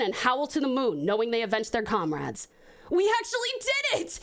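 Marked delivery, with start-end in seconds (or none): 2.9-4.2 high, joyful cry of victory; happy cheer. Acted. Young wolf Max cheering his pack's victory, high and joyful. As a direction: cheer it out, beaming with relief. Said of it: "Max says with a smile".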